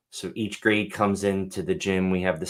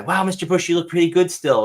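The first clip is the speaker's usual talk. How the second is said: put-on kid's voice; 8 semitones higher, 5 dB louder than usual. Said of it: "I wait for one of them to say".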